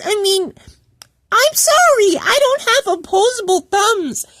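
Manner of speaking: high-pitched, whiny disbelief; indignant protest. Retold or imitated. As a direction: act out an offended, wailing defence. Acted indignant reply to being cussed out.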